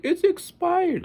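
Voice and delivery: very high, slow, dragged-out whine; whiny kid. Retold or imitated. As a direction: whine it like a worried child. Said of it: "The kid's like".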